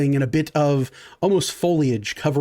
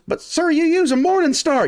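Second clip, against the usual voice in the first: high-pitched protesting henchman; bandit underling; 13 semitones higher than usual. Acted. Henchman corrects his boss, high-pitched protest. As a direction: object meekly in a high voice. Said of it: "He goes".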